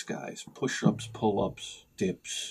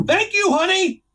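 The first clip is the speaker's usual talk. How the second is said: high, raised yell; calling out; 4 dB louder than usual. Retold or imitated. Man yells to honey about the sprinklers, high sarcastic call.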